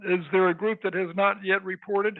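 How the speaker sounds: ordinary talk, nothing marked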